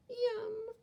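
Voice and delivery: high squeaky bird yum; a high greedy yum; hungry bird eyeing worms, high yum. Acted. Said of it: "the bird is going".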